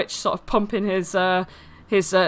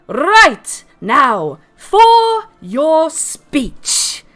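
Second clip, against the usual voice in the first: loud, high, drawn-out parade announcement; army general; 7 semitones higher, 6 dB louder, much slower than usual. Acted. Mister Witch addressing Flopsy on stage, general's commanding call. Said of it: "the swagger stick of an army general way".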